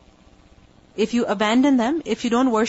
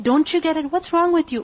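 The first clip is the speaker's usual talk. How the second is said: higher-pitched exasperated rebuke; frustrated; 6 semitones higher than usual. Retold or imitated. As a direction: voice a fed-up challenge to stubborn people. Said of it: "getting frustrated with their behavior".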